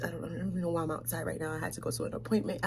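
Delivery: quick hushed whisper. Retold or imitated. Aunt hurriedly whispering to the helper. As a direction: rush it out in a hush. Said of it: "whispers in my ear".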